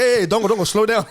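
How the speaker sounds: laughing while quoting himself to a friend; laughing imitation. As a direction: mimic yourself calling out while cracking up. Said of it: "I was like".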